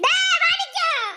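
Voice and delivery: drawn-out high call, slowed right down. Acted. Calling Manikkam for help, in a long high drawn-out call.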